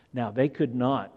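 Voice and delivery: normal talking voice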